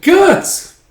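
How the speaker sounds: very high, loud squeal; exasperated high-pitched yell; yelling a name. Acted. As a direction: shriek his name to be noticed. Puck yells at ignoring Guts.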